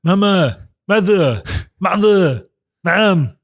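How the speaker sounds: a weak try at the bratty cartoon baby; Stewie impression. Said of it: "I did a cartoon voice".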